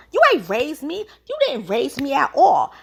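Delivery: mock angry British. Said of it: "But she went off".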